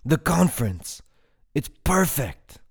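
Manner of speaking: high, loud excited exclamation; thrilled, emphatic delivery; enthusiastic. A narrator's reading. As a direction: burst out with a sudden great idea. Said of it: "with as much enthusiasm".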